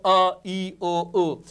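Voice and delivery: "अ, ई, ओ, उ" each vowel sounded out clearly and loudly; vowel sounds demo. Shown as a demonstration. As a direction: sound out "अ, ई, ओ, उ" one by one, like a teacher. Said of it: "जिसकी आवाज ऐसे निकल रही हो".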